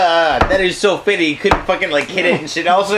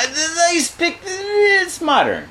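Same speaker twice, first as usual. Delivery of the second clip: high and slow; 11 semitones higher, slower than usual. Acted. Drawn-out high defence.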